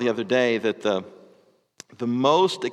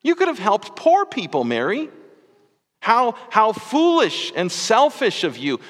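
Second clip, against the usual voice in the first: dinner guests scolding Mary, hammed-up indignation; mock scolding; 7 semitones higher than usual. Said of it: "they scolded her".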